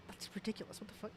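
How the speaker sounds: spooked whisper. Real, not acted. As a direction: whisper it, unnerved. Spooked by creepy piano music.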